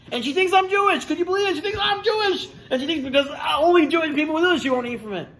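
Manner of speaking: high-pitched, worked-up disbelief; acted outraged amazement; incredulous. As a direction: protest in astonishment, voice shooting up. Son retells his disbelief to mother.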